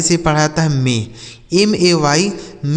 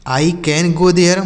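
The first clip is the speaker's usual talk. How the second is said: slow dictation; much slower than usual. Shown as a demonstration. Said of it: "teacher slowly repeats the sentence".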